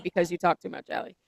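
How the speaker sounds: mock jab. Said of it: "I thought you were gonna say".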